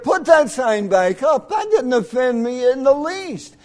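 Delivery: loud and high, laughing it off; shouting. Retold or imitated. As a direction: shout it across the room, amused. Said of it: "I said".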